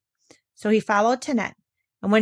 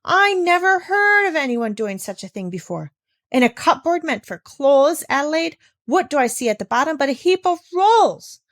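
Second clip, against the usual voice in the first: higher, louder scandalized ranting; outraged tirade; 7 semitones higher, 6 dB louder than usual. Acted. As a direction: air your disgust to everyone. Governess outraged at hidden rolls.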